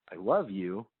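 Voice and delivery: ordinary delivery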